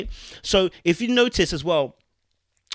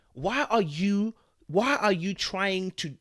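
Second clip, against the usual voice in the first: usual speaking voice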